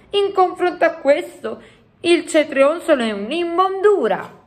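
high-pitched, emphatic giant verdict; exclaiming giant. A narrator's reading. Giant comparing the feast with cetrionzoli, exclaiming high. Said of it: "esclamò".

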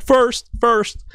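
high-pitched mock boast; mock eager commenter. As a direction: imitate someone eagerly claiming first.

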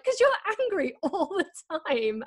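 high teasing friend voice breaking into a laugh; mock the friends' jab, laughing. Friends' joke gift teasing her, high and laughing. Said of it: "'cause they're like".

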